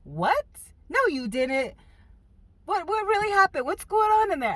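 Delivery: high, loud incredulous questions; can't believe what you hear; disbelieving husband. Retold or imitated. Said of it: "And he's like".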